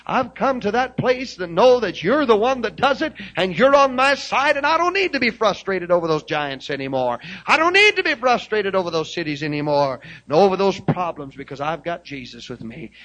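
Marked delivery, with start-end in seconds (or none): 0.1-11.4 loud, high building proclamation; fervent preaching. Acted. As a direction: lift it up and preach. Preaching climax.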